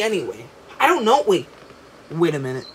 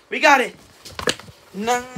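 normal talking voice

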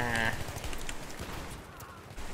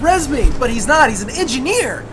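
higher, louder panicked pleading; frantic panic; 6 semitones higher, 6 dB louder than usual. Real, not acted. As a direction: panic as they swarm. Panic under attack.

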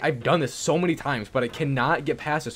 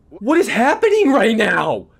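high, loud, drawn-out cries; furious yelling; 12 semitones higher, 11 dB louder, slower than usual. Real, not acted. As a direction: scream at the screen. Character going backwards.

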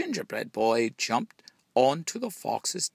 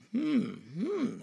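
high hummed sniff; 13 semitones higher than usual. A narrator's reading. Cow sniffs the gingerbread boy with a hum. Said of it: "sniffed the cow".

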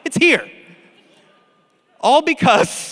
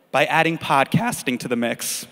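ordinary talk, nothing marked

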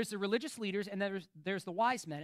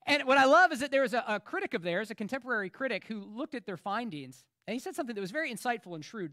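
usual speaking voice

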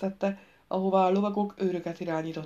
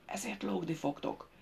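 hushed, far softer menacing whisper; whispered threat; 9 dB quieter than usual. A narrator's reading. The king threatens the man stooping beside him, whispered. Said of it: "Vincent odasúttogta neki".

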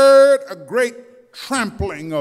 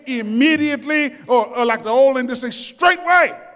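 higher, louder rising sermon climax; building preaching; 5 semitones higher, 3 dB louder than usual. Acted. Preacher rousing the church about instant healing, building. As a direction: build the fire word by word.

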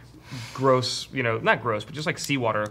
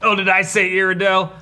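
put-on cowboy accent; 5 semitones higher, 6 dB louder than usual. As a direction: bellow it like a Wild West warden. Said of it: "why do you sound like a cowboy".

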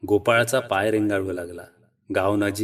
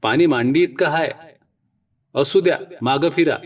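loud, higher call across rushing water; shouting; 5 semitones higher, 4 dB louder than usual. A narrator's reading. Wife shouting to her husband from the bank, loud and frantic. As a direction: yell at him to turn back from the flood. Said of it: "ती ओरडू लागली".

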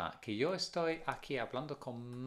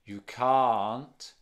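"can't" hit hard and much louder, rising; stress on can't; 8 dB louder than usual. Shown as a demonstration. Teacher to class, contrasting can and can't, stressed can't. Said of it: "la entonación".

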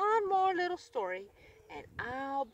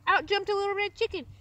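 higher, louder and fast, bursting in; sudden excited outburst; bursting in excitedly; 8 semitones higher, 7 dB louder, faster than usual. A narrator's reading. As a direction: burst in like a chick who can't wait. Chicken bursts into story.

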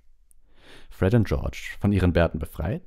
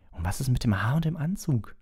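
high-pitched, knowing question; meaningful; 9 semitones higher than usual. A narrator's reading. Hermine notes Hagrid's hair and suit, meaningfully. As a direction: ask it pointedly in a higher girl's voice. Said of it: "setzte Hermine vielsagend hinzu".

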